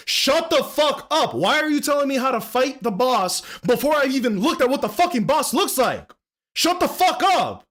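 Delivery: high, building angry outburst; heated snap at chat. Retold or imitated. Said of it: "I'm getting heated".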